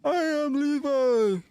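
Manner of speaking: very high, slow cartoon voice; drawn-out squeaky high voice; squeaky character voice. Squeaky character voice, huge pitch jump.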